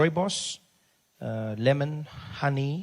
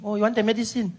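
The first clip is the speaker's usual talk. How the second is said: lightly voiced clerk; 7 semitones higher than usual.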